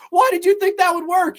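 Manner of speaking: high, loud imitated reproach; hammed-up high-pitched scolding; mock exasperation. Quarrel during car chase.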